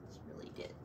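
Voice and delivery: hushed, sad whisper. Acted. Reader telling children Hush's hidden wish: sad whisper. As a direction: let the secret slip out in a whisper.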